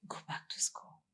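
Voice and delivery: soft unvoiced whisper repeating the message; whispered inner voice. Retelling the voice in her dream, softly whispered. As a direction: whisper it like a voice inside your head. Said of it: "All I could hear is".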